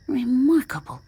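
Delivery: high-pitched marvelling; fascinated. A narrator's reading. Scientist examines the strange growth, fascinated. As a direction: marvel at the discovery, voice lifted. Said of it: "an expression of fascination".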